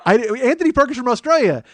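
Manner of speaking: ordinary talk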